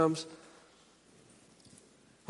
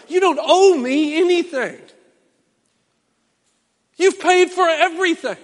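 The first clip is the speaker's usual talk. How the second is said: tearful; 11 semitones higher, 10 dB louder than usual. Retold or imitated. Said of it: "tearing up".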